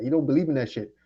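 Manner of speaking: usual speaking voice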